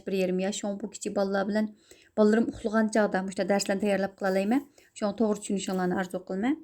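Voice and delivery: usual speaking voice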